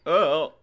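comic yelp of a man shot; mock wounded cry. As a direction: imitate being hit, hammed up. Mimics feeble reaction to gunshot.

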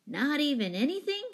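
high-pitched, rising question; dismayed disbelief. A narrator's reading. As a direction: ask it high, hoping against hope.

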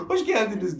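can't hold back the laugh while greeting; laughing welcome. Retold or imitated. Greeting the shocked dog owner, laughing through the welcome.

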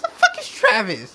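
high, baffled outburst; who on earth is that. Real, not acted. Confused outburst.

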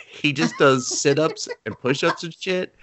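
ordinary delivery

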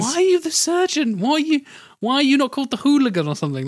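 high-pitched mock exasperation; mock indignant questioning. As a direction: demand an answer in comic disbelief. Mock challenge to film character.

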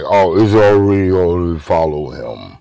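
low, dark cartoon villain voice; Skeletor impression. Retelling the officer's order, doing a low Skeletor villain voice. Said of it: "like Skeletor was saying it".

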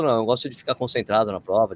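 normal talking voice

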